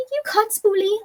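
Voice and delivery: high squeaky admission; squeaky Spoolie. Acted. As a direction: give up sheepishly in a squeak. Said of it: "your squeaky voice".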